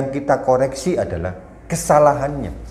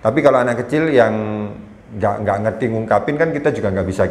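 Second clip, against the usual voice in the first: ordinary talk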